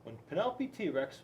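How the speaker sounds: usual speaking voice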